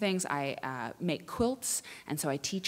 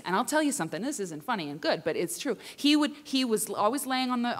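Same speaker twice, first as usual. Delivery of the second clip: ordinary delivery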